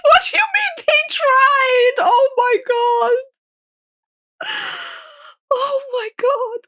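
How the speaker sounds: shouting while crying with laughter; laughing. Real, not acted. Laughing hard at video.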